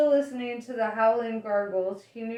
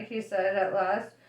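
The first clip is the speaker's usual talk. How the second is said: ordinary delivery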